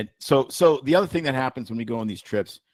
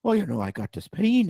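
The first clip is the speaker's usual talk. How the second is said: put-on Irish accent; 6 semitones higher than usual. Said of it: "I had a guy from Ireland".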